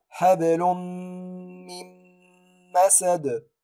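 حبل من مسد with both nuns merged into meem; tajweed recitation. Shown as a demonstration. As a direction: recite حبلم-مم-مسد with ghunna. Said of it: "أقول".

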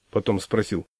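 ordinary delivery

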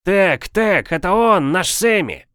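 high, loud cheer for a leader; supporter's high-pitched whoop; enthusiastic yell. A narrator's reading. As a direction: holler your support from the crowd. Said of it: "Мортимер завопил".

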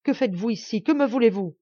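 menacing, rising to a shout; young man demands answers, loud and angry; terrible tone. A narrator's reading. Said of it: "demanda-t-il d'un ton terrible".

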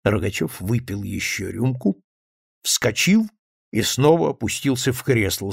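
ordinary delivery

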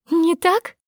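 sudden high outraged cry; outraged high-pitched outburst; sharp cry. A narrator's reading. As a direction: fling the words back in disbelief. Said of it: "вскрикнула Аля".

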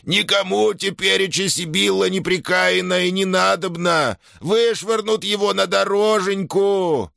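very high, slow whining lament; puppyish wail. A narrator's reading. Shaman keeps wailing.

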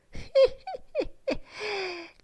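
put-on high, loud, full-bodied old man's laugh; rich hearty laugh. A narrator's reading. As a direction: let out a rich, gleeful laugh. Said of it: "rise con ricca letizia".

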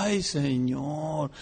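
very slow, drawn-out reverent sigh; reverent awe. Retold or imitated. Preacher and friends marveling at creation, slow reverent sigh. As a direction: breathe it out slowly in wonder. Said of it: "Nosotros decíamos".

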